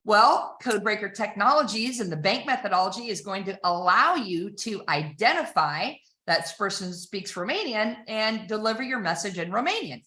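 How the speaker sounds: normal talking voice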